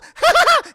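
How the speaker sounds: shrill, very high loud cackle; a toy's giggle gone wrong; creepy doll laugh. Retold or imitated. Chucky laughs after greeting Andy, very high and loud.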